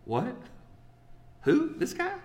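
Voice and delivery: squeaky rising disbelief; incredulous surprise. Retold or imitated. Crowd stares at the carpenter's son, rising squeaky disbelief. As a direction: pitch shoots up in mock doubt. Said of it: "they look at this carpenter's son like".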